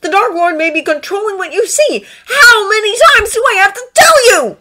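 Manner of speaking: high, exasperated outburst; stung and raising the voice. A narrator's reading. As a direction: build to a loud, high shout of frustration. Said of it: "Draco called, stung".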